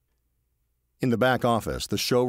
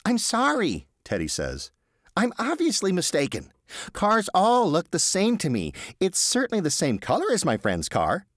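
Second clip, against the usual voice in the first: higher, apologetic Teddy; sheepish Teddy; 14 semitones higher, 4 dB louder than usual. Acted. Teddy apologising to the receptionist, higher sheepish voice. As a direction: backpedal politely, a little flustered.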